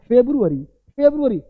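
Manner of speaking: "फेब्रुअरी" as FEB-ru-a-ree; correct pronunciation. Shown as a demonstration. Teacher reads what is written, correct pronunciation. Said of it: "तो क्या बोलेंगे?".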